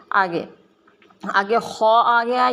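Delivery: usual speaking voice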